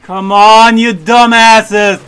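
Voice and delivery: angry shout at team; furious yell. Real, not acted. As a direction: bellow it, fed up. Yelling at team.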